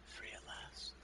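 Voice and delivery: whisper. Pretend. Whispered relief.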